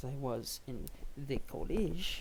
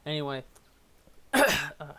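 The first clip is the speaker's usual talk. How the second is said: normal talking voice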